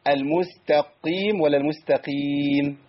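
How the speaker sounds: slow "المستقيم" with held qaf, then with single qaf; doubled versus single qaf. Shown as a demonstration. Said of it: "القاف هنا كأنها شددت".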